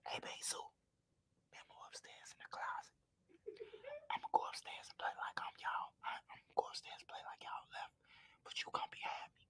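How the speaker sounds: hushed secret whisper, no voice at all. Retold or imitated. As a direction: whisper it like a warning no one else should hear. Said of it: "would have been like".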